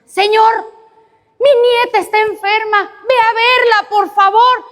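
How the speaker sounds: put-on high, urgent old woman's plea; pleading grandmother. Grandmother begging the Lord to visit her sick granddaughter, high and urgent. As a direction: beg as if it's the last chance.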